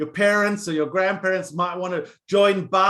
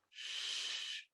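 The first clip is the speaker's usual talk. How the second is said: put-on whispered hush to a sleeping child; soft shush; 18 dB quieter than usual. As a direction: gently shush him awake. Mother wakes him from nightmare.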